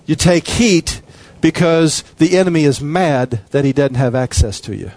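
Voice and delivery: usual speaking voice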